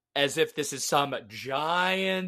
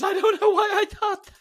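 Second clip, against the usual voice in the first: laughing; 5 dB louder, faster than usual. Real, not acted. Laughing at own mishearing.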